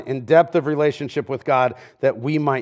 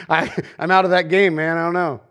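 "I don't know" with a laugh, playing innocent; mock clueless laugh; naive laughing dodge; 2 semitones higher than usual. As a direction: shrug it off, giggling, out of that game. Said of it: "Be the one who goes".

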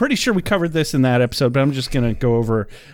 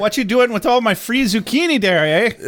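high, hammed-up Yooper Canadian accent; caricature Canadian accent; 7 semitones higher than usual. Cohost imagines the truck driver objecting, mock Yooper Canadian accent. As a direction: put on a singsong Canadian local, raised pitch. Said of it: "I'm not gonna try to do the accent".

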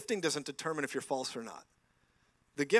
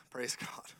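ordinary talk

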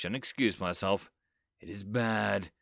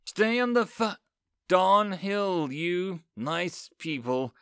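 calling to the army behind him; calling back; 6 semitones higher, slower than usual. Acted. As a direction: shout back to the troops, then soften. Hero calls back to his army.

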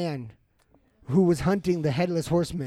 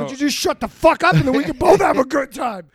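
high, loud mocking voice; mocking yell; 9 semitones higher, 10 dB louder than usual. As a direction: shout it in a silly put-on voice.

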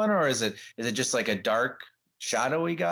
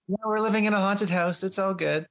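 higher-pitched fake cheer; mock nonchalance; 6 semitones higher than usual. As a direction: pretend it's no big deal. Mimics couple shrugging off haunting.

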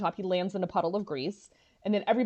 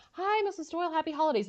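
higher, chipper hello; lightly voiced bright greeting; cheery phone greeting; 9 semitones higher, faster than usual. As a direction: put on holiday cheer for the mother. Frasier greeting Roz's mom.

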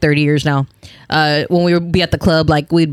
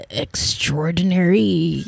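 "extraordinary" said slowly and softly; careful big word; 5 dB quieter, much slower than usual. Retold or imitated. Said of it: "I'll be like".

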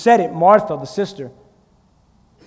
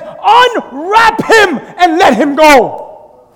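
very slow, loud proclamation; commanding shout; 3 semitones higher, 4 dB louder, much slower than usual. Acted. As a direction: command them to set him free, drawn out. Jesus's command at tomb.

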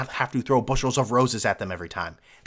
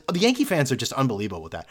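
ordinary talk, nothing marked

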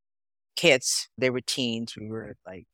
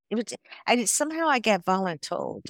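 ordinary talk, nothing marked

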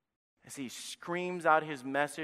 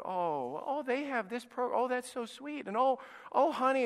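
mock gushing; 8 semitones higher than usual. Preacher mocking comfort-seeking churchgoers, higher mock gushing. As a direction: coo over the cute brochure, mocking.

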